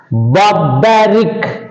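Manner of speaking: "Barbaric" said slowly with weight on BA in the middle; slow bar-BA-ric for "Barbaric"; stress on second-last syllable. Shown as a demonstration. Teacher modelling the fourth rule, "Barbaric" stressed on its middle syllable. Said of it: "you have to put the second syllable".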